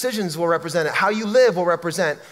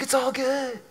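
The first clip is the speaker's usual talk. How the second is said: higher, softer hippie echo; earthy hippie; 6 semitones higher, 5 dB quieter than usual. Retold or imitated. Preacher echoes the stranger's creed, soft hippie impression. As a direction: lightly mimic the spacey hippie. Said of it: "stereotypically earthy".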